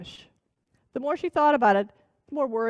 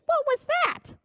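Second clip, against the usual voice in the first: high, sharp witch demand; shrill witch. Acted. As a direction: snap the question like a startled hag. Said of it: "shrieked the old witch".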